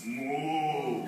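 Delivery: low, soft moo; cow moo. Acted. Storyteller showing farm sounds to children, low mooing. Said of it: "They made weird sounds like".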